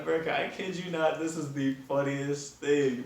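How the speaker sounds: high-pitched, amused insistence; laughing disbelief. Real, not acted. Still cracking up at the story.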